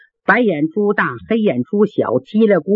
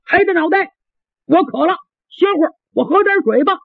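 simple lad; 7 semitones higher, faster than usual. Acted. Simple lad asks to rest.

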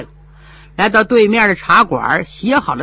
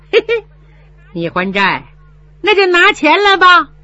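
sneering steward; 9 semitones higher, 5 dB louder than usual. Acted.

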